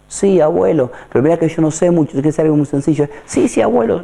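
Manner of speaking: put-on young shopkeeper. Shopkeeper voiced in story.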